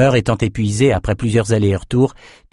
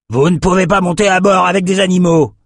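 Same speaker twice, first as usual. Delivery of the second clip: high, raised voice of a ship owner; indignant refusal; 9 semitones higher, 4 dB louder than usual. A narrator's reading. Owner stopping a woman boarding with animals, indignant refusal. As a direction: refuse them firmly, high and forceful.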